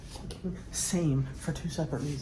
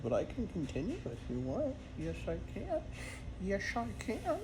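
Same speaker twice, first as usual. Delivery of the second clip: soft, playful cooing that lilts upward; soft pet talk; 5 semitones higher, 6 dB quieter than usual. Real, not acted. Owner offers pet more scratches, soft cooing. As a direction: reassure a pet in a gentle singsong.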